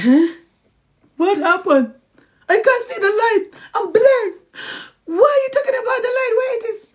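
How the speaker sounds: high, loud hammed-up wailing; mock blindness act. A liar fakes shock to dodge the blame, in a hammed-up high wail. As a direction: play dumb with a fake desperate cry. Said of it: "And they're like".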